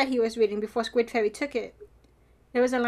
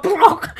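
loud, higher-pitched plonk; imitated car bump; 4 semitones higher, 8 dB louder than usual. Fan mimicking cars over the speed bump, loud plonk. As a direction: punch out the noise of a car hitting a bump. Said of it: "you just see the cars go".

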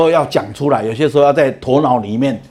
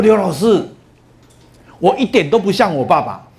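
retold cheerful brag, pitched higher; happy boast; 6 semitones higher than usual. Son happily tells the teacher he's unlike his dad, bright and pleased. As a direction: chirp it out like a proud son. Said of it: "他非常的高兴的跟我讲".

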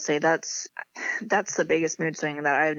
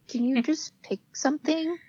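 exasperated plea; 9 semitones higher than usual. Retold or imitated. Bridesmaids' reply, acted.

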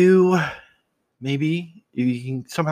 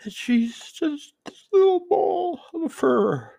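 high, drawn-out cooing over a kitten; doting dad talk; 11 semitones higher than usual. Real, not acted. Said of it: "I talk like that as I'm a dad".

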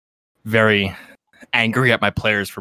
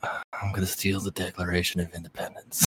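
a hint of Nicolas Cage, low and muted; lightly voiced Cage, much softer; half-hearted movie star voice; Nicolas Cage impression; 6 semitones lower, 13 dB quieter than usual. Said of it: "how Nicolas Cage sounds".

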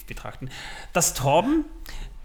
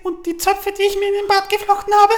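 Stewie from Family Guy; 14 semitones higher, 5 dB louder, much faster than usual. Retold or imitated. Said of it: "du klingst so ein bisschen wie Stewie aus Family Guy".

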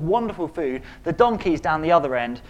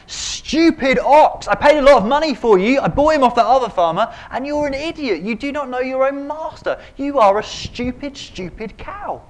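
much higher and louder, yelling at the ox; furious farmer; 9 semitones higher, 8 dB louder than usual. Acted. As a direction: rage at a stupid ox you paid good money for. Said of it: "The farmer would be furious".

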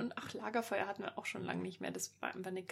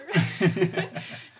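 loud laugh; 10 dB louder than usual. Real, not acted. Joke about Stopfleber.

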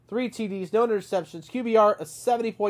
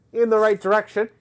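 usual speaking voice

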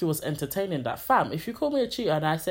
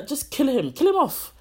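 exasperated; 8 semitones higher, 4 dB louder than usual. Real, not acted. Exasperated plea to kill off character.

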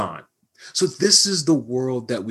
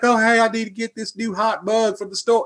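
put-on country accent; 8 semitones higher than usual. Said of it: "you're really in the country accent today".

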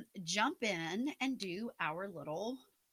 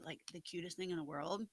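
whispering; 3 semitones lower, 8 dB quieter than usual. Real, not acted. Fond aside about a child.

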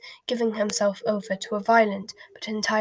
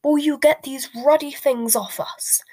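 higher, louder uncle's shout; raised-voice outburst, higher and louder; angry shout; 5 semitones higher, 5 dB louder than usual. A narrator's reading. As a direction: shout it in exasperation at them. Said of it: "Uncle Vernon shouted".

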